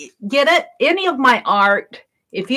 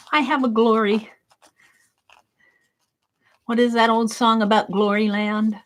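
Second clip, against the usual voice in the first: usual speaking voice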